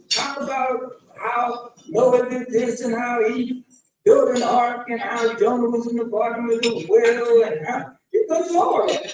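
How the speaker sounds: usual speaking voice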